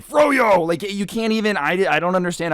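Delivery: high, loud mock-angry outburst. Shown as a demonstration. Showing how absurd angry froyo sounds, hammed-up angry shout. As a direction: yell it in exaggerated anger. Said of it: "just say froyo angrily".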